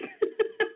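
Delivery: short high giggle breaking out; burst of laughter. Real, not acted. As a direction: crack up at the memory. Laughs at own story.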